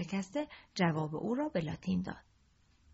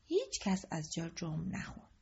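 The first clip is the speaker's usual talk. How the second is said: ordinary talk, nothing marked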